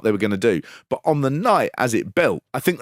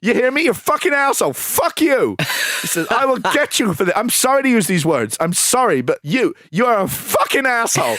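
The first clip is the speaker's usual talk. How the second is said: retold shout; 10 semitones higher than usual. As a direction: bellow it like Kaufman losing it on TV. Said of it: "And Kaufman shouts, he shouts".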